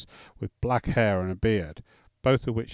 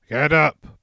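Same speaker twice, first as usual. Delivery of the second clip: loud, booming command from a warrior; 6 semitones higher, 8 dB louder than usual. A narrator's reading. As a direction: boom it out like a wave hitting rocks. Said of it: "boomed the voice of Grettir".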